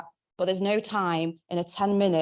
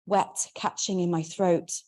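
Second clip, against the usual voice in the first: plain reading voice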